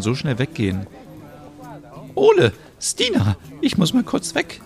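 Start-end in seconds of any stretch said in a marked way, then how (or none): 2.1-4.6 put-on very high, thin old-lady voice; old neighbour offering help, high and frail; elderly Merit. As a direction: play a sweet old neighbour.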